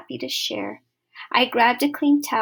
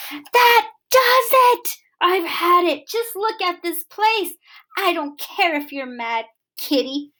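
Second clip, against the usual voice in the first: high-pitched exasperated scolding; exasperated outburst; 6 semitones higher, 4 dB louder than usual. Acted. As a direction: blow up at the messy cat. Owner loses temper at cat.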